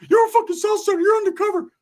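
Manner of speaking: very high, squeaky accusing voice; cartoonishly high mock tirade from a wired cousin; Ricky. Cousin high on drugs accusing narrator, frantic squeaky imitation. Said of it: "Ricky busting the motherfucker just whooping".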